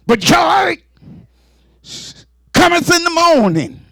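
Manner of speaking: ringing joyful preacher cry; joyful shout. Real, not acted. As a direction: proclaim it triumphantly.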